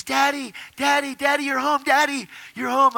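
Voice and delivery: put-on high, loud child's cry; excited young daughter. Daughter greeting her returning father, excited child's voice. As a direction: imitate a little kid thrilled Daddy's back. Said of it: "my kid comes running".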